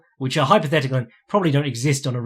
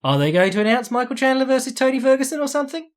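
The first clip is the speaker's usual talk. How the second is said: much higher, eager mock-excited question; hopeful excitement; 10 semitones higher than usual. Fan watching promo hoping for a fight announcement, excited. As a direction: perk up like a fan sensing big news. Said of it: "and I'm like".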